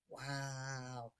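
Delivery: quiet wonder. Retold or imitated. Teller on Ritalin, quiet wonder.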